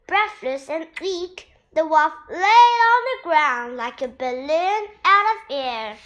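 ordinary delivery

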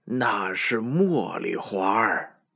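slow, calm grandpa goat; slow old goat voice. Acted. Grandpa stroking beard.

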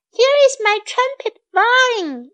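high-pitched, mild narrated yell; storybook yell. A narrator's reading. Lynn shows off her trumpet vine, high mild yell. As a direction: call out high without full volume. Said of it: "yelled Lynn".

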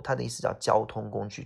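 normal talking voice

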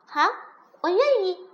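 high, loud, ready yes; eager agreement. Acted. Princess accepts frog's condition, eager.

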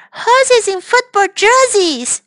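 high-voiced little boy; Tim joking. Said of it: "said Tim".

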